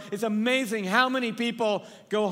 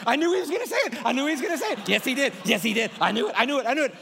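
high, fast excited chatter, imitating; hammed-up excited squeal; giddy crowd member; 4 semitones higher, 3 dB louder, much faster than usual. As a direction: play the delighted crowd who saw it coming. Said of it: "They get their arms around each other like".

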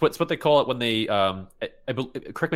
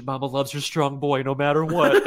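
slow, laughing mother's comfort; laughing through a mock lullaby; mock soothing; 5 semitones higher, 3 dB louder than usual. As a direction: coo it while cracking up. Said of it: "the mom was just like".

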